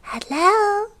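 tiny, high-pitched hello; very high little creature voice; little goblin voice. Acted. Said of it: "a little voice".

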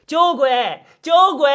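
high-pitched hollering for someone; urgent call. Acted. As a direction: call out sharply from afar. Neighbour bursts in calling uncle.

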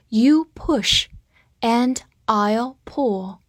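slow, broken into short chunks with pauses; read-along model. Shown as a demonstration. Teacher having students repeat the sentence, slow read-along. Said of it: "好，跟我把句子再读一遍".

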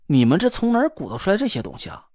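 ordinary delivery

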